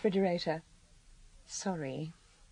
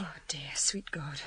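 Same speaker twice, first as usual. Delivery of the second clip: horrified hush; shocked whisper; 4 semitones lower, 8 dB quieter than usual. Acted. Journalist hearing the grim details, shocked whisper. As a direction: breathe it out, appalled.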